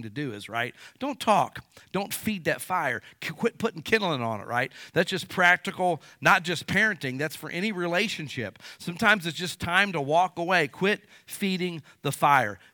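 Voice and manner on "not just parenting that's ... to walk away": usual speaking voice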